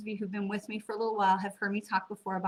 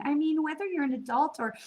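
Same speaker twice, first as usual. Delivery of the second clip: normal talking voice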